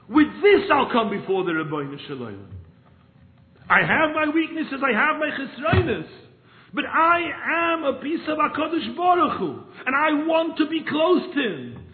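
loud, high impassioned plea; preaching at full force; fervent preaching. Acted. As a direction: pour the heart out before God. Preaches the rebbe's words.

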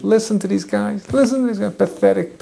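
high-pitched, scoffing ridicule; mocking amusement. Eliyahu making fun of the Baal prophets, high mocking jeer. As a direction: jeer at them as if tickled by their failure. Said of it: "And he's laughing away".

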